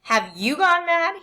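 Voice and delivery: high, slow mocking repeat; father mocking his wife's outburst; mocking echo. A narrator's reading. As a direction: parrot her back. Father echoes mother mockingly.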